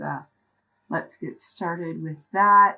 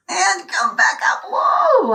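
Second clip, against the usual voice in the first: loud strained cry on the push; straining cry; 11 semitones higher, 8 dB louder than usual. Real, not acted. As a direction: cry out with effort. Push-up effort, crying out.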